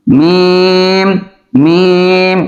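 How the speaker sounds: মিম with lips closed, the m held four beats long; long drawn-out meem. Shown as a demonstration. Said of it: "যতবার লিখবেন ততবার পড়বেন".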